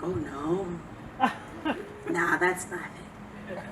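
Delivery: soft, low mock whisper of fear; frightened hush. Woman alone in a hotel at night, soft and scared. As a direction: hush it like someone scared in the dark. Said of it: "I was like".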